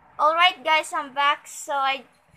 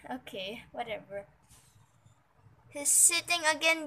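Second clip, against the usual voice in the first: ordinary delivery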